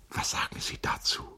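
quick, awed whisper; startled hushed voice. A narrator's reading. August asks what Charlotte says to the verse, in a startled whisper. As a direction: whisper the question as if frightened by it. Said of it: "noch immer mit der erschrockenen Stimme".

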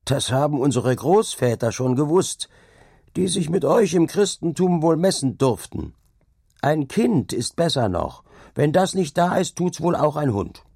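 cheeky. A narrator's reading. Cheeky laborer talks back to the dike reeve, impudent. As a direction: talk back to your master with impudence. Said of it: "mit frechen Lachen".

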